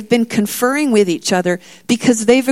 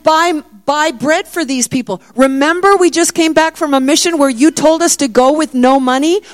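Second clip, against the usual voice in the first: sarcastic frustration; 8 semitones higher, 4 dB louder than usual. Acted. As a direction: scoff at an impossible order. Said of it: "incredulous frustration, mingled even with a hint of sarcasm".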